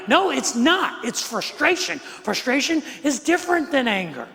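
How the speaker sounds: high, emphatic imitated protest; insistent correction. Wife correcting her husband in an argument, high insistent protest. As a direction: push back hard, pitched well up. Wife's side of retold argument.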